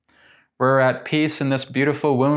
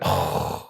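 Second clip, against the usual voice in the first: mock deflated sigh; 7 semitones lower, 4 dB quieter than usual. Acted setback.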